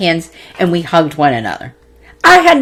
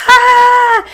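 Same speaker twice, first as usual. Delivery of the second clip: very high, louder mock scream; imitated scream; 4 dB louder than usual. Reliving her cathartic scream, a very high mock scream. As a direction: let out the release scream, way up high. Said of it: "screaming so loud".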